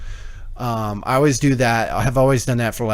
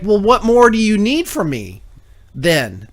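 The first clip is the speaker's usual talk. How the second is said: higher, louder exasperated questioning; retold fed-up demand, pitched up; 8 semitones higher, 4 dB louder than usual. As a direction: press them in mock exasperation. Acted exasperated questioning of airline staff.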